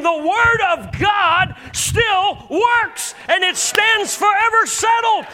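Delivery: high, loud preaching shout; fiery sermon peak; shouting preacher. Real, not acted. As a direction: cry it out to the whole room. Preacher at full force.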